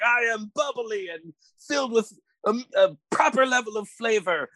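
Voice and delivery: high, bright supervillain boast; bubbly villain voice. Acted. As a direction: announce yourself as a fizzy villain. Seltzer villain introducing himself.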